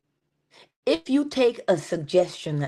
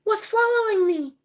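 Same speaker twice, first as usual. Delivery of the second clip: put-on high, scared little girl voice; squeaky child asking in alarm; frightened little girl; 14 semitones higher, 4 dB louder than usual. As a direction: play the startled small child. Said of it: "she turns around and she's like".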